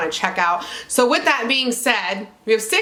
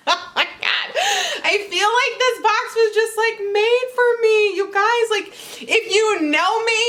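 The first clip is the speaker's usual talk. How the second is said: much higher, laughing squeals; excited; 8 semitones higher than usual. Real, not acted. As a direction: swoon over the box. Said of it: "I'm trying to be cool".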